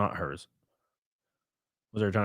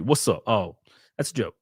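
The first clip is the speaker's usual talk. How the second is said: usual speaking voice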